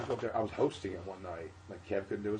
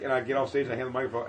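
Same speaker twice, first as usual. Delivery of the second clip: normal talking voice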